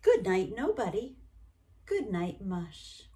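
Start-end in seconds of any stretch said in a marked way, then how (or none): none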